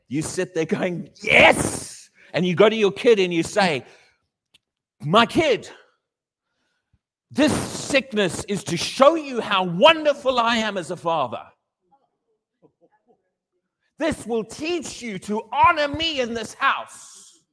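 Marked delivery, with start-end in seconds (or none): 1.2-2.1 mock glee. Mock gleeful parent over sick kid. 5.0-17.4 a hint of a pompous father, slowed down; mock stern father. Preacher voicing a cruel father.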